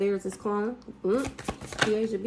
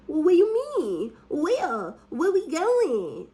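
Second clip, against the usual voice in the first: much higher, louder, babyish put-on voice; mock a grown woman talking like a baby; mocking, high baby talk, drawn out slowly at the end; goo goo gaga voice; 9 semitones higher, 6 dB louder, slower than usual. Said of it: "That type of goo goo gaga".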